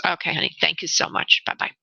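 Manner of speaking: quiet, breathy thank-you; soft goodbye. Real, not acted. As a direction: drop to a soft farewell.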